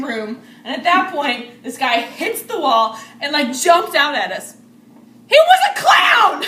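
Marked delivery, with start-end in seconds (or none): 5.3-6.4 laughing shout. Real, not acted. Telling friends about the clown, a laughing high shout. Retelling the clown jump scare.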